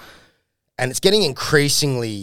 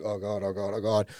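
soft, low repeated dread; ashamed muttering; 3 semitones lower, 8 dB quieter than usual. Retold or imitated. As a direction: mutter it under your breath, head down. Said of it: "sitting there with their heads down".

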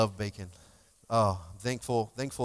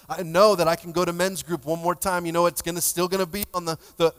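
higher and louder, pressing the point; raised preaching voice; 6 semitones higher, 9 dB louder than usual. Real, not acted. Pastor urging men to come back to group, raised voice. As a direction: lift it and drive it home.

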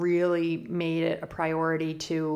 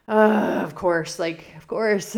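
exasperated; 2 semitones higher, 7 dB louder, slower than usual. Retold or imitated. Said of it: "And I was just like".

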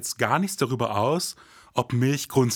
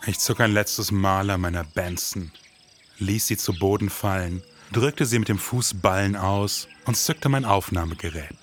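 low, brooding crime-story narration; moody voiceover over music; noir detective narration; 5 semitones lower than usual. A narrator's reading. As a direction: tell it like a hard-boiled detective.

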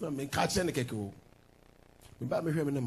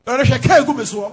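loud retelling; 8 semitones higher, 15 dB louder than usual. Retold or imitated. Retelling wife glaring at him.

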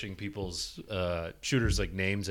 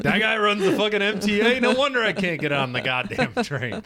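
loud; 8 semitones higher, 4 dB louder than usual. Pretend. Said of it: "You're like".

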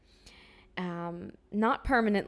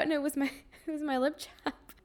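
giggling through the reveal, pitched higher; laughing; 8 semitones higher than usual. Real, not acted. Telling listeners her pocket mix-up, laughing. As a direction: laugh while admitting the joke was on you.

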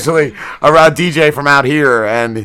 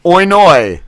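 mock Australian accent; 4 semitones higher than usual. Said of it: "Could you say "Oi noi" for me".

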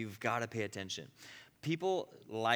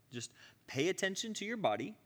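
ordinary talk

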